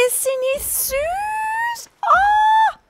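very high, drawn-out gushing squeal; adoring squeal. Real, not acted. Player shows viewers a cute creature, adoring squeal. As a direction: melt over the cuteness in a high squeal.

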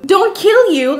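loud, high-pitched incredulous echo. Retold or imitated. Guest reacting to host's casual text, incredulous. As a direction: throw it back in disbelief.

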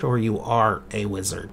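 ordinary talk, nothing marked

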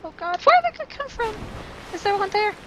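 high, panicked squeak; panicking. Real, not acted. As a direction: voice shoots up in real alarm. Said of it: "Okay, I'm panicking".

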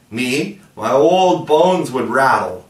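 a hint of a creaky old father; mock scandalized. A narrator's reading. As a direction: pretend to be horrified and ancient. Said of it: "pretended to be scandalized".

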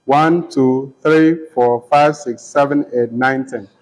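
each number a separate beat; counting syllables. Shown as a demonstration. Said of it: "So you have second line".

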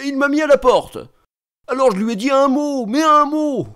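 high-pitched outraged protest; indignant. Acted. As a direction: protest with rising, offended indignation. Indignant schoolboy retells being thrown out. (indigné)

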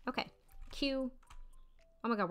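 ordinary talk

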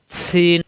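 সিন with a long hissed s and held vowel; whistling sibilant. Shown as a demonstration. Teacher pointing out the escaping air, whistling sibilant. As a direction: let air stream out on সিন. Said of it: "বাতাস বের হচ্ছে".

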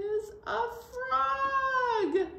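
high drawn-out reveal; playful reveal. Acted. Teacher revealing the frog to the children, high and slow. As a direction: stretch it out as a big surprise.

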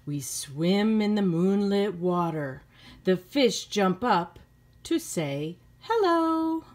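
slow, gentle storybook reading; reading to children. A narrator's reading. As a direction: read it like a bedtime story to kids. Teacher reads picture book aloud.

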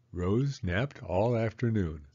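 plain reading voice

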